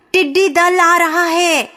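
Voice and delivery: high-pitched alarm call; calling a warning. A narrator's reading. Someone warns the village of the locust swarm, calling out.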